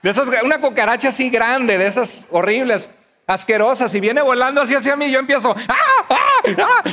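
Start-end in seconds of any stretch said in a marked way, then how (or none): 5.7-6.8 repeated high, loud comic shrieks; short bursts of pretend screaming; mock panicked scream. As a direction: shriek in hammed-up panic at a flying cockroach. Acts his cockroach panic.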